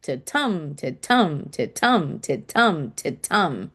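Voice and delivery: low, even "to-tum" pulse, weight on each "tum"; heartbeat rhythm. Shown as a demonstration. Teacher likening iambs to a heartbeat, low rhythmic "to-tum" stressed on "tum". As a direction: beat "to-tum" like a heart, light "to", stronger "tum". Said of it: "It's kind of like a heartbeat".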